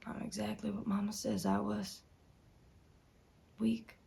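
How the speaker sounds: soft, defeated admission; quiet self-reproach. Acted. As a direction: admit it under your breath. Bitter self-judgement.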